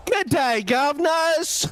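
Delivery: high, drawn-out cartoon Cockney greeting; high-pitched mock London cabbie accent; put-on British accent. Shown as a demonstration. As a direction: hail the crowd in a hammed-up British accent. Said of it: "when I put my British accent on".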